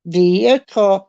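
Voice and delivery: "vehicle" with a drawn-out, bumped middle syllable; American "vehicle". Retold or imitated. Canadian showing Americans their word, "vehicle" imitated with a little bump. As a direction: stretch "vehicle" into three beats, vee-ih-cull. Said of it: "when you say vehicle, it's always".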